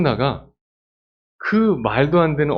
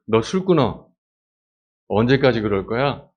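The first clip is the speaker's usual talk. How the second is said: a stern accusing scold, a bit louder; scolding priest; 4 dB louder than usual. Acted. Priest Eli scolds praying Hannah, stern and accusing. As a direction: tell her off for being drunk.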